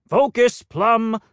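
high, loud stern command; stern peacock. Acted. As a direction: snap him back to attention. Hampstead snaps at Plum.

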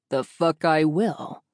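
slow, loud possessive growl; growling Jack. A narrator's reading. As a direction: growl it out like a jealous husband. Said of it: "Jack continues to growl".

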